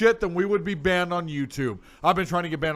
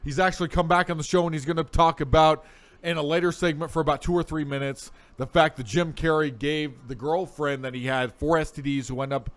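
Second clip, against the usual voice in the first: normal talking voice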